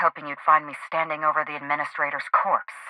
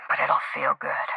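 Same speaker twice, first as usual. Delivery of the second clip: softer, breathed promise of revenge; cold whisper; 3 semitones lower, 6 dB quieter than usual. Acted. Avenger answering the inspector about killing, menacing whisper. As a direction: savour it quietly. Vengeful killer's quiet reply.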